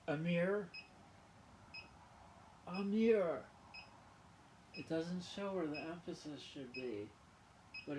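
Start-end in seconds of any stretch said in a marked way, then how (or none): 0.1-0.7 Amir? asked with a rising tune, louder; questioning Amir. Shown as a demonstration. Asking viewers whether Amir is right, questioning. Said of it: "do we agree on the pronunciation?". 2.7-3.4 Amir said loud and higher, as ah-meer. Shown as a demonstration. Asking viewers about the pronunciation, says Amir loudly. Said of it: "do we agree on the pronunciation?".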